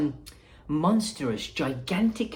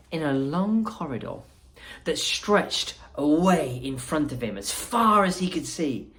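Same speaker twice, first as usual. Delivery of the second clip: plain reading voice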